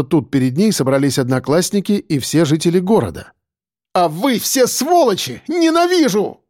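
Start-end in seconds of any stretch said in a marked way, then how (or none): 3.9-6.4 high, drawn-out cry of hatred; hateful outburst. A narrator's reading. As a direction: let it burst out, climbing to a scream. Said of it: "сорвалось у неё".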